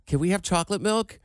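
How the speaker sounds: ordinary talk, nothing marked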